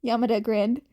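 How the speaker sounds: usual speaking voice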